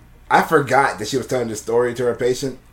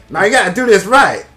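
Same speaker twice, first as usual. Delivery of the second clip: high and loud, hammed-up Madea bossing the actors; mock Madea directing; 9 semitones higher, 9 dB louder than usual. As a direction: play a bossy drag auntie on set, higher and louder. Tyler Perry directing as Madea.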